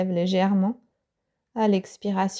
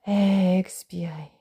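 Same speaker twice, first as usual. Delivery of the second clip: whispered exhale cue; slower than usual. Acted. Teacher cueing the out-breath, slow whisper.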